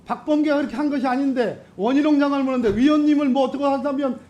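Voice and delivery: fast, high-pitched protest; heated rebuttal. Real, not acted. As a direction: rush out an indignant objection.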